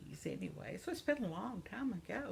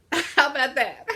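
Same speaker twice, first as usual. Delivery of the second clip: loud, high laughing question; cheerful laughing quip; 7 semitones higher, 13 dB louder than usual. Real, not acted. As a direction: laugh it out, delighted. Laughing at herself.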